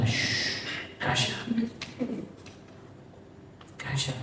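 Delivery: shh susurrado, mucho más suave y sereno; susurro calmante. Acted. Jesús calma la tormenta. (Jesús le habla a la tormenta, susurro sereno)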